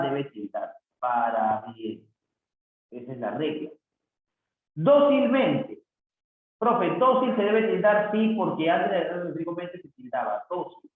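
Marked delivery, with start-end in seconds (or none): 4.7-5.8 Dócilmente slow, high and loud on "Dó"; sounded-out stress. Shown as a demonstration. Teacher showing where the tilde goes, Dócilmente stressed clearly. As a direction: hit the "Dó" in Dócilmente and take it slowly.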